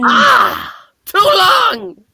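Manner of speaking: high, loud cry of pain and shock; anguished villain scream. Acted. Hobgoblin shrieking after staying too long in the vat, high and loud. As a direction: scream it out as the chemicals burn.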